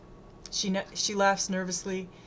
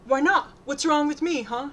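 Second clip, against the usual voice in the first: louder, higher aggrieved challenge; raised, whiny confrontation; hurt demand; 7 semitones higher, 7 dB louder than usual. A narrator's reading. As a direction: demand it, wounded and pushy. Rejected boy demands a reason.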